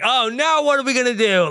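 high, fast, hammed-up fretting; mock dismay. Voices Lewis at a loss.

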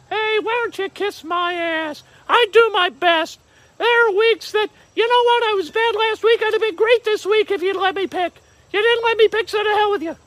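very high-pitched, indignant orb voice; squeaky put-on voice snapping back; high comic voice of an offended orb; salty talking orb. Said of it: "Salty from the orb".

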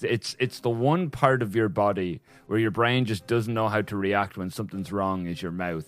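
normal talking voice

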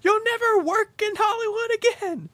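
high-pitched, hammed-up ultimatum; mock furious threat. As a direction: hurl it after a fleeing star. Director threatens fleeing star.

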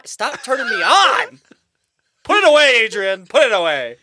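high, loud mock squeal; hammed-up flustered shriek. Teasing mock arousal.

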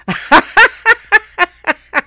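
high burst of loud laughter; loud mocking laugh. Real, not acted. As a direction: cackle at the absurd claim. Mocking a silly article.

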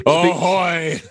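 loud, high pirate ahoy; pirate hail. Pretend. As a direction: call out like a ship captain. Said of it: "The sexy boat captain's coming out".

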